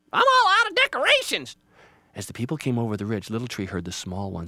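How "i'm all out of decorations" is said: very high, loud bird screech; panicked Jaybird. Acted. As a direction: squawk it in a flap. Jaybird squawks.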